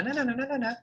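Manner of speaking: very high, sing-song clarinet chatter; mother's clarinet voice. Retold or imitated. Mother responding to dad through the wall, high clarinet tones. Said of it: "I would hear them going back and forth".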